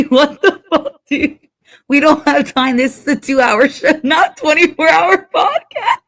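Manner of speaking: high, laughing mock indignation; laughing outrage. Real, not acted. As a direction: scold through laughter.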